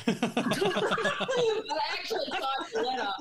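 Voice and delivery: laughing. Real, not acted. Laughing at a silly letter.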